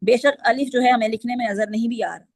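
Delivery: ordinary talk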